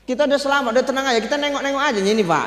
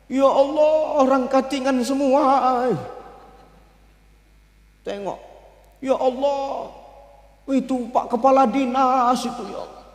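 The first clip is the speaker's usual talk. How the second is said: weeping Prophet; 6 semitones higher, slower than usual. Acted. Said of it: "Nangis Rasulullah".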